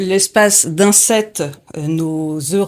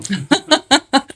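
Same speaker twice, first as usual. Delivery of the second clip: light laugh; 6 semitones higher than usual. Real, not acted. Host laughs at guitarist joke.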